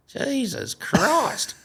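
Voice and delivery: put-on slow, high drawn-out old man's gasp; old neighbour handed a rooster, slow and high; old man exclaiming. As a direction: stretch it like a startled eighty-year-old.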